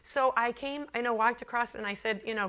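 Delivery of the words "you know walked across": normal talking voice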